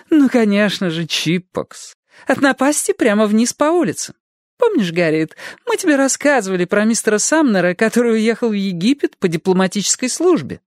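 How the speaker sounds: high theatrical gushing; hammy stage actor. A narrator's reading. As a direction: gush for the guest. Adelaide voiced by narrator.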